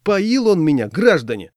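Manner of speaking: put-on high, loud drunken appeal; loud wounded protest, falling at the end; aggrieved drunk. A narrator's reading. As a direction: cry out to the passengers in outrage. Drunk man appeals to passengers.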